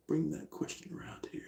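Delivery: whispered, barely audible mumble; absent self-talk. Real, not acted. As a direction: murmur to yourself while looking for something. Muttering to self while searching.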